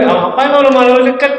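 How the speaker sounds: a teasing, incredulous reply; mock incredulous host. Host teasing the neighbour who lives close, mock disbelief.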